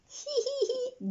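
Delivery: high little giggle; giggling. A narrator's reading. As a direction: giggle it like a toddler. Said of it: "giggled George".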